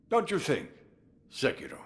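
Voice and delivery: teasing question. Acted. Old lord teasing Sekiro about their ties, playful rising question. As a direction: jump up high on think, then let Sekiro drop.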